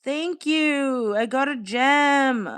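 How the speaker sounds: high, loud and drawn out with delight; squealing, stretched-out thank-you to the chat; excited thanks. Real, not acted. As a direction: gush over the gift like a live streamer. Thanking viewers for stream gifts.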